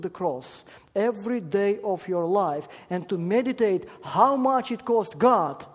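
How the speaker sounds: loud, high preaching build; full-voiced pulpit preaching; preaching at full force. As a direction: proclaim it to the congregation with fire. Preacher rising to emphasis.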